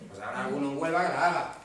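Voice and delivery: usual speaking voice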